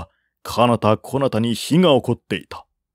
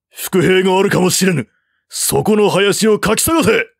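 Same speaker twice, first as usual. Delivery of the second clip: higher, louder barked command; commanding order; 8 semitones higher, 3 dB louder than usual. A narrator's reading. General ordering soldiers to search the forest, commanding shout. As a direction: order the troops to search the woods.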